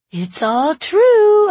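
loud, drawn-out squeaky pet voice; very high, slow talking-to-the-dog voice; high-pitched dog voice. A narrator's reading. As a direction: gush it in a high doggy voice. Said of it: "in my high-pitched Yo-Yo voice".

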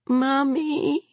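high, thin, plaintive call; small child calling miserably; miserable child. A narrator's reading. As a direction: draw it out high and forlorn. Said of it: "Tad said miserably".

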